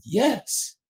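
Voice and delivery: high-pitched yes that leaps out; spontaneous yes. Retold or imitated. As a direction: blurt it out high, without thinking. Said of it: "it leapt out of me".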